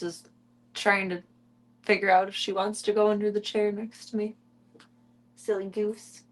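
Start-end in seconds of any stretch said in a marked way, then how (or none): none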